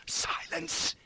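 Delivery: sharp whispered hush; high hissed whisper; urgent hiss. A narrator's reading. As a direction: hiss it for silence. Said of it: "he hissed".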